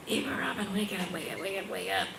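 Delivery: hushed whisper waking someone; urgent whisper. Retold or imitated. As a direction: whisper to wake her gently. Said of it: "she, like, is like".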